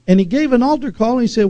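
usual speaking voice

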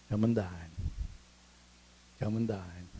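low, soft, unhurried call to eat; gentle invitation. Acted. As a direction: invite them tenderly, leaving room between phrases. Jesus gently invites disciples to eat.

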